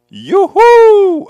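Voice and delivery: high, loud sing-song yoo-hoo; calling out. Real, not acted. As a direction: call across the room to someone. Hailing a viewer on screen.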